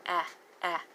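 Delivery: short "Ah, ah", the vowel of cat; two separate, brief "Ah, ah" sounds. Shown as a demonstration. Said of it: "There's that short A sound".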